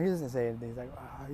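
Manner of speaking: ordinary delivery, nothing marked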